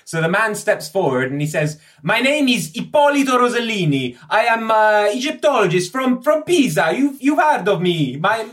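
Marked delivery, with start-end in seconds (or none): 2.0-8.5 put-on high, loud Italian-accented voice; eager, loud scholar from Pisa; excitable Italian Egyptologist, eager. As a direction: introduce yourself grandly, pitched way up. Ippolito introduces himself.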